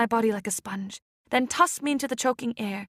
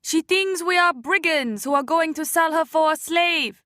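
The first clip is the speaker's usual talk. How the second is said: higher, louder, slow reproach; scolding woman; 5 semitones higher, 4 dB louder than usual. Acted. Boat woman rebukes the men, slow firm reproach. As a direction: chide the men for scaring the child. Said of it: "scolded the woman in the boat".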